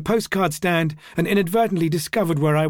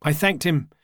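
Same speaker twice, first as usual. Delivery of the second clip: usual speaking voice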